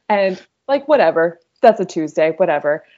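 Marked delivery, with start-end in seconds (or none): none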